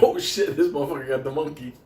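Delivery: high, loud alarmed yelp; mock alarm. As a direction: jump back in mock panic. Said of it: "I know I'd be like".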